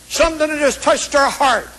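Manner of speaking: ordinary talk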